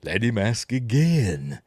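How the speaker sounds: slow, put-on American accent. Quoting the film, very American accent. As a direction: stretch it out in a mock American accent. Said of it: "In a very American accent".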